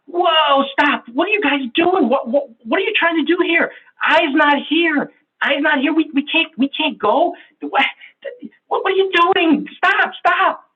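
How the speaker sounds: the S teammate halting the meeting, high and flustered; alarmed S type. Acted. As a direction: panic that a teammate is missing. S panics that I is missing.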